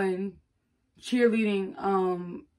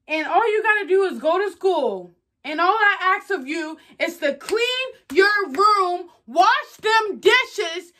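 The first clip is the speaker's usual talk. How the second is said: exasperated parent; 6 semitones higher, 5 dB louder than usual. Acted. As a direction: hammer each demand at the child, incredulous by the end. Acted parent scolding over chores.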